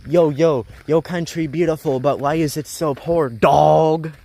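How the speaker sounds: loud, high mock New York tourist voice; rude tourist. Tourist from New York mocking the poor country, in a loud high voice. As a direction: sneer it like a tactless tourist. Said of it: "be like".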